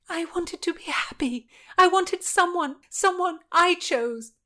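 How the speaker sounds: high, impassioned self-defence; anguished protest. A narrator's reading. Anne defends her choice to Margaret, anguished.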